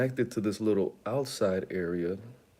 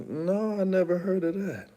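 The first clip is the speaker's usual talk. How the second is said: high, amazed denial; 8 semitones higher than usual. Retold or imitated. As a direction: sound genuinely floored. He answers amazed.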